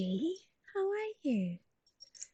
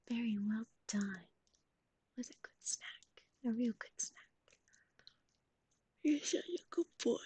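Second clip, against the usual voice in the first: whisper; 2 semitones lower than usual. Acted. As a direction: whisper it like a reward. Whispers praise after snack.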